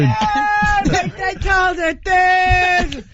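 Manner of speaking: high-pitched yelled "Dude"; high squeaky shout. Pretend. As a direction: squeal it out at the top.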